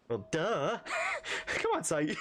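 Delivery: giggling, high and playful; laughing. Pretend. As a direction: laugh through the cheeky line. Cheerful giddy reading with laughs.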